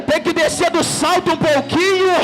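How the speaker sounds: fervent revival preacher at a climax; full-force preaching. Real, not acted. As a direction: push each phrase out hard, climbing in pitch.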